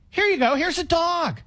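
mock shelter worker handing off a dog; mock giveaway pitch. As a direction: hand over the free dog like a too-good deal. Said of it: "No, this is a lie".

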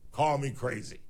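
normal talking voice

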